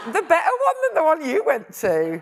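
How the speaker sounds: laughing, high and loud playful jab; laughing tease. Real, not acted. Boasting her grammar school beat his, laughing tease.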